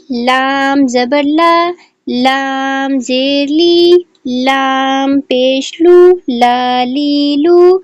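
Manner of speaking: slow, drawn-out syllable drill; slow drill reading. Shown as a demonstration. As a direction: read each sound slowly and clearly like a Qaida teacher. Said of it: "اس کو ہم ریڈ کریں گے".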